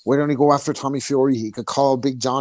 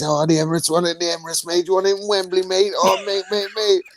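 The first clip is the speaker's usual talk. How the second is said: mock Eddie; 7 semitones higher than usual. S0 mimics Eddie celebrating.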